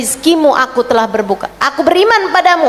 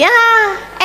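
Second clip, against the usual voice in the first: normal talking voice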